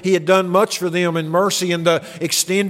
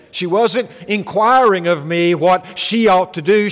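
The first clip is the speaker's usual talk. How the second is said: usual speaking voice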